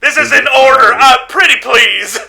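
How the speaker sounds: loud, high, pleading command; desperate order. Acted. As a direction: order them while begging. Same officer ordering, then pleading.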